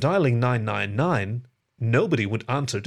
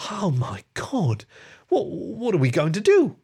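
slow gasp of dismay, then high stammered question; 7 semitones higher than usual. A narrator's reading. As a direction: start slow and stunned, then rise into worry. Character gulps, horrified at empty town.

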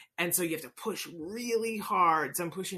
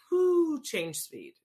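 whoo exclamation; 10 semitones higher, 5 dB louder, much slower than usual. Real, not acted. Exclaiming about pace of year.

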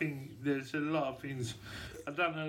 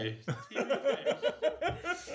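mock posh; 10 semitones higher, much slower than usual. Mocking phrase repeat.

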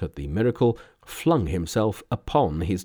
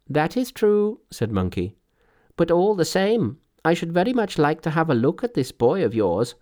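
higher-pitched, brisk Monkey voice; inquisitive Monkey; 8 semitones higher, 3 dB louder than usual. A narrator's reading. Monkey asking to see the old man's boy, eager. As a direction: press the request in a high voice.

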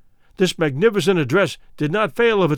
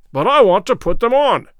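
louder and higher, a flustered protest; nervous roar; 5 semitones higher, 5 dB louder than usual. Acted. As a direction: roar it, panicking over his clothes. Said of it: "roared Good in nervous English".

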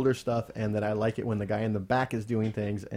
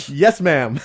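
loud polite reply; 4 semitones higher, 14 dB louder than usual. Retold or imitated. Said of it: "I'm like".

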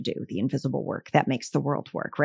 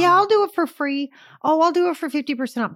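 eager giving in; 8 semitones higher, 3 dB louder than usual. Retold or imitated. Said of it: "That's self-betrayal".

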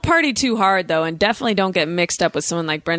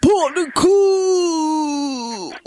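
drawn-out Australian accent; 7 semitones higher, much slower than usual. Shown as a demonstration. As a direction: belt out "Park the car" as a slow Australian shout, no R's. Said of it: "you just don't say the R's".

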